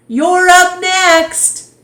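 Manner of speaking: high, loud, slow call from afar. Acted. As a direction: shout across the court. Emilio calls from tetherball court.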